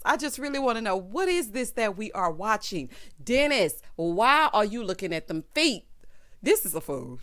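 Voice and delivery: usual speaking voice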